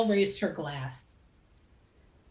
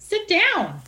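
1 urging; 10 semitones higher, 6 dB louder than usual. A narrator's reading. Wynn tells Ron to sit.

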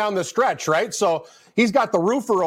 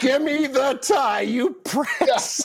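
mock shout; 3 semitones higher, much slower than usual. Fan cursing at soccer team.

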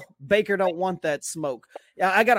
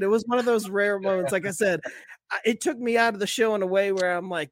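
usual speaking voice

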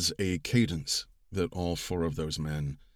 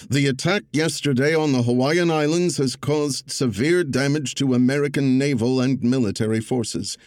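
louder, stately oratory, no impression; Roosevelt's cadence; 8 semitones higher, 8 dB louder than usual. Narrator reading Roosevelt's address to Congress, louder stately cadence. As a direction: give it presidential weight without mimicry. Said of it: "I embraced the cadence of it without trying to do the voice".

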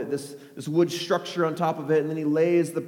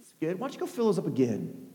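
soft, offhand order; hushed casual approval; understated request; 2 semitones lower, 8 dB quieter, faster than usual. Acted. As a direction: quietly send helpers back, deadpan. Elijah coolly repeating the order.

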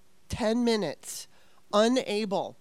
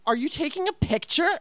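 high-pitched, accusing retold question; bitchy challenge; 9 semitones higher, 4 dB louder than usual. Confronting the tow guy over his phone, bitchy. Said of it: "I was being such a bitch".

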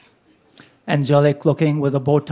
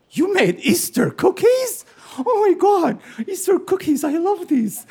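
thrilled brother; 12 semitones higher than usual. Retold or imitated. Brother seeing mother's Easter cookies, high and delighted. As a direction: gush over the cookies.

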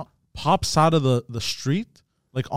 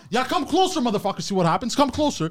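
mock taunting yell; 8 semitones higher than usual. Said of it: "I'm like".

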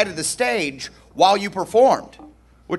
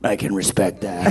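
gruff general voice; 11 semitones lower, 4 dB quieter than usual. Retold or imitated. General's dry reply, retold.

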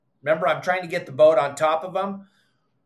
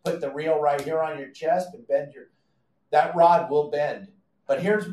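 normal talking voice

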